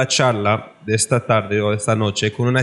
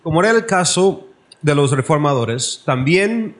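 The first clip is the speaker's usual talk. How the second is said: formal oratory; 5 semitones higher than usual. Acted. Speaker opening the debate to the audience, in raised formal oratory. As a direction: proclaim it from the pulpit.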